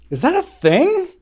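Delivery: very high, loud questioning rise; incredulous question. Retold or imitated. Joking about a hooded puffy vest, incredulous. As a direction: squeak it out in mock disbelief. Retold puzzled reaction to vest idea.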